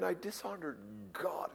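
usual speaking voice

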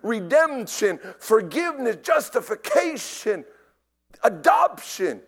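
loud, high preacher's proclamation; impassioned preaching. Real, not acted. Preacher listing salvation's blessings to the congregation, impassioned.

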